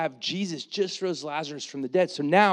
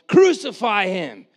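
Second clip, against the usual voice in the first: crowd yelling; 5 semitones higher, 8 dB louder, slower than usual. Retold or imitated. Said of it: "they're yelling".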